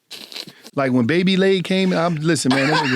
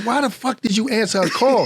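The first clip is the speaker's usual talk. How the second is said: mild retold anger; 6 semitones higher than usual. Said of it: "I was so mad".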